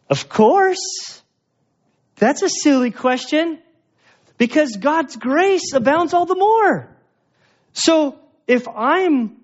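mock the carefree sinner in a high put-on voice; careless sinner voice. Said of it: "The first camp would say".

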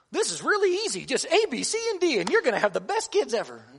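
high, loud, swooping sales pitch; breezy mock how-to pitch; mock easy-fix salesperson. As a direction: oversell it as effortless, voice leaping up high. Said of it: "to make it sound like".

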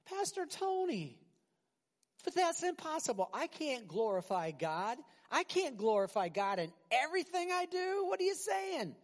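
high, incredulous objecting voice; doubting congregant. Acted. Congregant protesting to Pastor Tony that glorifying God is impossible, high and incredulous. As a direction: plead it's impossible like a worried churchgoer.

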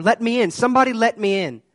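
high, loud panicked cry to be let in; terrified child yelling. Retold or imitated. Boy pounding the church door begging to be let in, panicked yell. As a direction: yell it like a scared boy locked outside.

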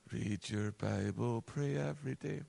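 facetious sigh. Shown as a demonstration. Preacher mocking a boring rule to the congregation, sighing and facetious. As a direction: drag it out like a bored chore. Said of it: "forgive me for being facetious".

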